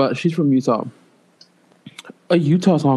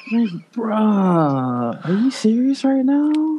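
lightly voiced mock slang, rising high; a hint of her put-on talk; mock bruh; imitating her; 4 semitones higher, much slower than usual. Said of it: "how to imitate it".

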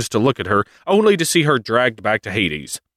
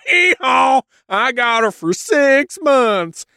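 much higher, slow hillbilly gloat; gloating Hades; slower than usual. Retold or imitated. Hades gloating at Demeter in a slow goofy high voice. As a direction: crow over the win. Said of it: "Hades is like".

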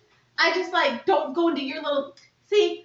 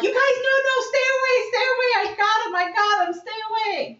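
high, urgent cry to keep them back; frantic warning; 8 semitones higher than usual. Retold or imitated. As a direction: shoo them away in a panic. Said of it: "and I'm like".

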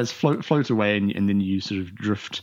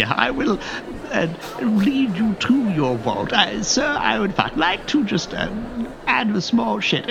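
prim bank teller; 10 semitones higher than usual. Acted. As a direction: play the overly polite teller, pitched up. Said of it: "And he goes".

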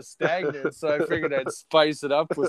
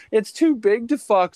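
higher, louder mock complaint; imitated girlfriend; 9 semitones higher, 6 dB louder than usual. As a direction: mimic a protesting girlfriend in a raised voice. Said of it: "She's like".

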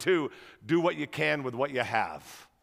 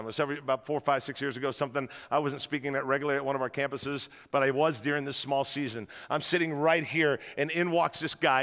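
ordinary delivery

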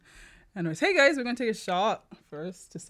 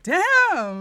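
loud; 10 semitones higher, 12 dB louder than usual. Retold or imitated. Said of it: "I was like".

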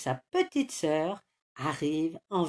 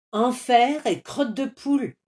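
louder, higher exclamation; astonished oath; 5 semitones higher, 5 dB louder than usual. A narrator's reading. Rooster sees friend's golden fleece, astonished cry. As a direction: blurt it out in amazement. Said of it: "s'écrie Coqueluche".

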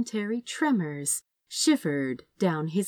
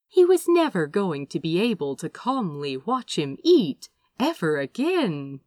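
plain reading voice

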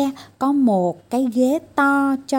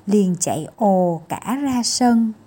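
ordinary delivery, nothing marked

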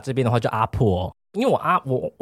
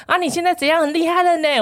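imitated fawning grandma. Grandma calls the famous grandson, higher fawning grandma voice. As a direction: play the grandma who suddenly flatters her famous grandson, pitched up.

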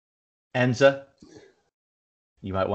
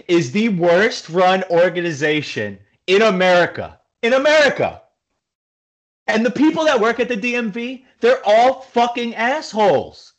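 raised, heated rant about the DMV; angry rant; 7 semitones higher than usual. Real, not acted. Fed-up driver rants to podcast co-host about the DMV, angry and high. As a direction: vent it, pitch climbing higher. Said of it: "I've been holding that rant in".

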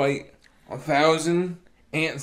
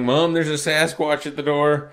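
louder mock kid's call of alarm; calling to mom; 3 semitones higher, 8 dB louder than usual. Kid at the door warning mom about a huge visitor, calling out. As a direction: call it out to mom in the next room.

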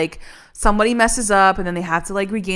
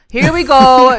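louder, higher exasperated repeat; 4 semitones higher, 9 dB louder, slower than usual. Retold or imitated. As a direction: throw it out louder. Two takes of a reaction.

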